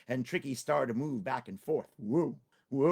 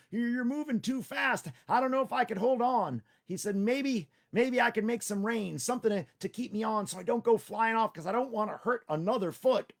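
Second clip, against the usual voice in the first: Tricky the rider; 9 semitones higher, 3 dB louder than usual. Acted. Tricky asks Tiger for a saddle, higher anxious voice. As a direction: play the small nervous rider.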